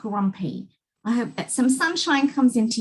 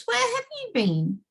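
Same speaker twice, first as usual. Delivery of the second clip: cheerful greeting; 13 semitones higher than usual. Acted. Mister Happy greeting cheerfully.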